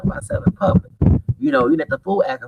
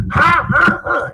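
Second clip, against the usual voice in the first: mocking laugh; 4 dB louder, much slower than usual. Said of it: "And he act".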